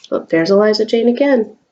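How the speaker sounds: ordinary delivery, nothing marked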